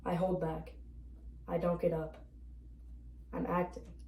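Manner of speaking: ordinary delivery, nothing marked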